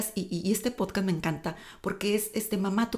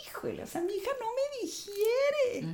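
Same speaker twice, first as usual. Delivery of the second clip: mock dismay; 5 dB quieter than usual. Retold shocked inner thought.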